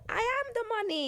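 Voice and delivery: high, loud, triumphant declaration; loud proclamation. Retold or imitated. Mentee grasping her mentor's point, loud proclamation. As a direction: proclaim it like a revelation.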